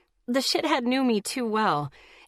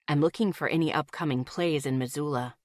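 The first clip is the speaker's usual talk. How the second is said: matter-of-factly; 6 semitones lower than usual. Acted. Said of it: "he said matter-of-factly".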